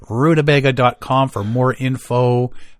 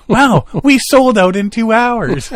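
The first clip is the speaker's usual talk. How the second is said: slow, high, loud bragging; boastful organizer; 10 semitones higher, 6 dB louder, slower than usual. Pretend. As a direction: gloat over the sellout. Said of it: "symposium scalpers".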